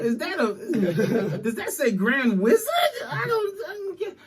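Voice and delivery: high, laughing squinting bit; laughing mock confusion. Joking about reading the robe, laughing high. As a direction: play dumb through laughter. Mocking a Klan robe joke.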